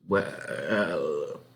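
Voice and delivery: low, drawn-out well; stall, thinking it over; slow doubtful well; hesitant farmer. Acted.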